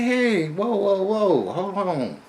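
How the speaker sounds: high, alarmed "whoa, whoa"; startled protest. Retold or imitated. Man startled at the counter, high alarmed protest.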